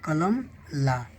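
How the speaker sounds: slow, drawn-out read-aloud; slow reading demo. Shown as a demonstration. Teacher completing the sentence for a pupil, slowly. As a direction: land the finished word slowly and clearly. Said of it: "ई यानी कि मेरी".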